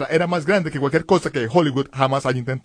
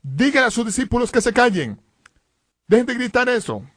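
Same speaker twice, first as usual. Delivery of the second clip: high-pitched, insistent command; orden imitada; 7 semitones higher than usual. Retold or imitated. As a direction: order it like an affronted priest halting a noisy crowd. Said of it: "el sacerdote lo detiene y le ordena". (orden tajante en tono alto)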